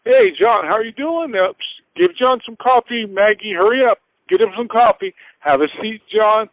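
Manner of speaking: high, bright-eyed host voice calling out for coffee; eager bank president. Retold or imitated. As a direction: play the fawning banker greeting a valued client. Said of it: "the bank president is like".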